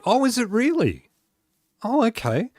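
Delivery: high-pitched, genuine surprise; surprised. Real, not acted. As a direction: react with a high, swooping "oh". Learns viewers confuse the accents.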